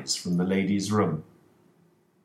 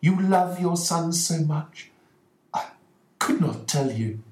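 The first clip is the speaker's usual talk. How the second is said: much higher, gentle wife's voice; 10 semitones higher than usual. Acted. Mrs Ferguson telling her husband why she kept silent, higher voice.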